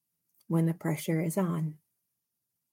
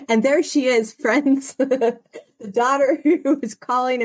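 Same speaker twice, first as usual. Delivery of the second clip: laughing; 6 semitones higher, 7 dB louder than usual. Real, not acted. Laughing at daughter's interruption.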